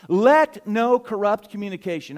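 loud proclamation leaning hard on "Let"; stress on LET. Real, not acted. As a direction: punch the word "Let" as a command. Proclaims verse, then remarks on "let.

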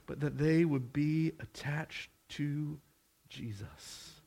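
hushed, earnest close; hushed preaching. Acted. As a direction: pull them in quietly.

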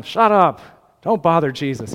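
retold crowd telling someone off; hushing sharply. Crowd silencing the blind man.

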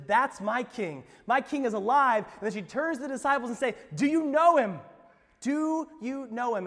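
full-force preaching. Acted. Pastor proclaiming Mary's recognition, loud and fervent. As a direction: proclaim it to the rafters.

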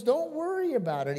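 high-pitched, lightly acted brush-off; breezy reassurance. Mother to her doubting son, breezy reassurance. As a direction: voice a mother waving off worries.

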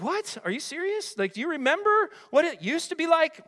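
high-pitched, disbelieving questions; incredulous God. Acted. God answering Israelites who miss slavery, comically incredulous. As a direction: play God flabbergasted, voice way up high. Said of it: "God's like".

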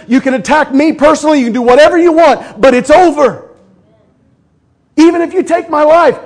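high, loud, gloating jeer at the enemy; loud mocking victory taunt. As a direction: rub the defeat in his face.

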